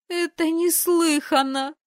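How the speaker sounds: high, loud, slow exclamation; scandalised shriek; indignant sister; outraged cry. Acted.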